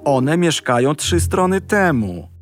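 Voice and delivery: wyższy, żałosny głos wilka; płaczliwy wilk. A narrator's reading. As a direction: zagraj smutnego, rozżalonego wilka. Voices the wolf. (wyższy, płaczliwy głos wilka)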